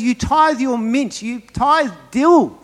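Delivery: high, slow, indignant rebuke; angry Jesus. Acted. Preacher quoting Jesus scolding the Pharisees, indignant. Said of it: "read angry Jesus".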